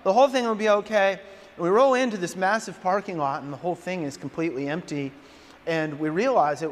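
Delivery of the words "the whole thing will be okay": higher and much louder, rushing out a panicked plan; frantic plan. Retold or imitated. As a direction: bark out a panicky plan while running late. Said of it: "I'm like".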